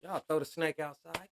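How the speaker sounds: ordinary talk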